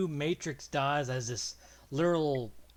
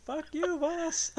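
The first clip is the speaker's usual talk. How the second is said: high mock defiant voice; 3 dB louder, slower than usual. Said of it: "It's like".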